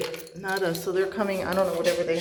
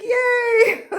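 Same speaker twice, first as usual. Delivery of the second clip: high squeal of joy with a laugh; excited squeal; 6 dB louder than usual. Real, not acted. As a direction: squeal it out, bursting with delight. Power company on its way.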